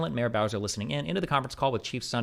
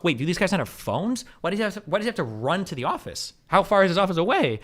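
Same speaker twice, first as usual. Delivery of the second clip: incredulous; 7 semitones higher, 4 dB louder than usual. Real, not acted. Incredulous at generals running offices.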